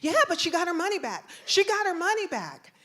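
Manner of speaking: put-on high, loud, insistent kid voice; protesting child. Son answers the officer about mom's money, loud high protest. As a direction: blurt it out like an accused child.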